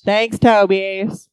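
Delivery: put-on southern accent. Continuing the bit, slowed.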